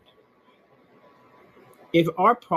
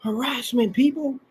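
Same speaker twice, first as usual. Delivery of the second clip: mock scream of harassment; 8 semitones higher than usual. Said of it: "He screams".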